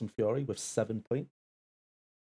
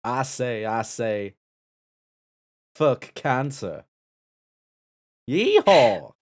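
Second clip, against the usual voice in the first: put-on Texan accent; 11 dB louder, slower than usual. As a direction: belt it like a Texas cowboy. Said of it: "your best Texan accent".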